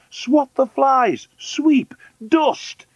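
very high, loud, slow commands; imperious high-pitched wife; bossy orders. Acted. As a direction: call out each chore separately, high. Wife firing off chores.

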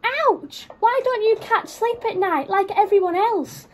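mock howl of a sore dog. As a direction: yelp then whine it like a hurt dog. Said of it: "howled the dog".

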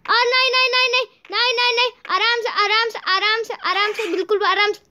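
high, anxious repeated warnings; panicked caution. Real, not acted. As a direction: plead for care as disaster looms. Near fall, urging caution. (घबराहट में सावधान करते हुए)

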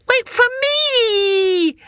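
slow, high, tearful wail; abandoned rabbit sobbing after his friend; crying rabbit. A narrator's reading. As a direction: plead through tears, drawn out. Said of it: "cried the rabbit".

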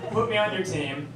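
ordinary delivery

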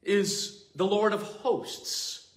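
high, slow preaching voice; slow solemn proclamation. Acted. Preacher proclaiming God's title to the congregation, slow and solemn. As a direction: proclaim each word to the congregation.

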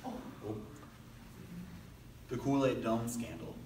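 normal talking voice

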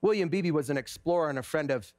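low, soft preaching over music; reflective sermon. Acted. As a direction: draw the congregation into quiet awe.

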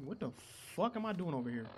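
normal talking voice

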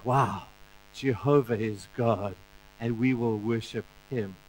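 whisper-like wonder; the people awed by the fire; awed crowd. Retold or imitated.

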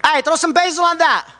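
hammed-up Italian order in a mock Italian accent. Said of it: "We're like true Italians now".

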